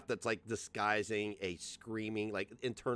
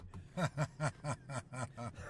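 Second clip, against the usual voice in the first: villain laugh; 5 dB quieter than usual. Real, not acted. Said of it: "I love your villain laugh".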